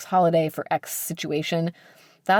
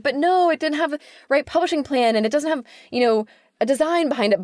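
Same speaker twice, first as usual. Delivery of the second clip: raised, insistent defence; passionate pleading; 9 semitones higher than usual. Retold or imitated. Editor retelling arguing for a book, higher passionate protest. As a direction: plead the book's case with passion. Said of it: "me being passionate and saying".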